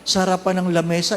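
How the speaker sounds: normal talking voice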